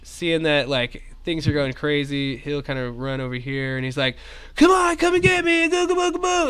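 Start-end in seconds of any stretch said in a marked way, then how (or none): 4.6-6.5 high, loud taunt; taunting call. Acted. NPC taunting goblin to lure it away, high and loud. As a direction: bait the enemy with a high yell.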